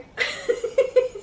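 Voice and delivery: airy giggle up high; high breathy laugh. Real, not acted. Asked about going back.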